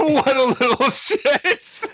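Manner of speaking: high laughing remark. Real, not acted. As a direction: laugh through the words. S1 mocking character.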